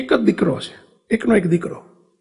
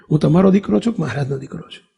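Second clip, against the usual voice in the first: faster, pressing for a clear answer; quick insistent question; 4 semitones lower, faster than usual. Retold or imitated. Son pressing his mother to state clearly whose son he is, fast. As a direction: rattle it off to settle the point. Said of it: "ચોખવટ કરવા જગ્યાએ".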